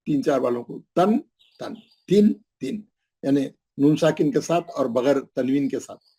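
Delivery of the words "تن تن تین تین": تن تن then تین تین said higher; teacher showing تن against تین; sounding out tanween. Shown as a demonstration. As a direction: contrast تن with the spelled-out تین. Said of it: "کھول کے بھی بتا دیا".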